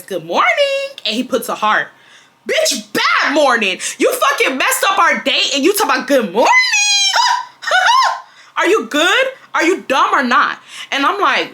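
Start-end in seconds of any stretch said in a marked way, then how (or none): 0.0-0.9 high sing-song good morning; mock sweet text. Quoting the boyfriend's cheery text in a mock high voice. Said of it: "and he says". 2.5-10.6 higher, louder, exasperated telling-off; indignant outburst. Retold or imitated. Venting at the boyfriend who skipped the date, louder and higher. Angry reply to his text.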